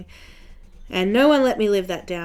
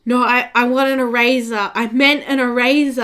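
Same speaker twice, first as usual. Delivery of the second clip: louder, higher, hurried backpedal; retold embarrassed blurt, raised voice; flustered correction; 4 semitones higher, 7 dB louder than usual. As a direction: scramble to fix the mistake, embarrassed. Went bright red, correcting herself.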